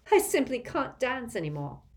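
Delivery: panting from dancing; breathless after dancing; out of breath. Acted. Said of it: "she panted".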